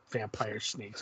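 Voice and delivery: a quiet flat repeat of "Vampire snakes"; deadpan disbelief. Real, not acted. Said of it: "Come on, Bill".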